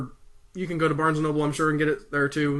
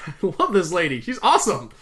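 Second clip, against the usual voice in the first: ordinary delivery, nothing marked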